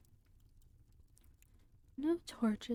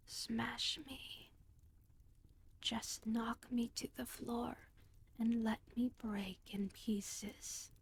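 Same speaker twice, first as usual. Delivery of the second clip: hushed, urgent near-whisper; almost whispering; 6 dB quieter than usual. A narrator's reading. Talking skull urging the thieves, almost whispering. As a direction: rush it out under your breath. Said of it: "speaking very quickly, almost whispering".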